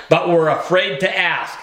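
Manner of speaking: ordinary delivery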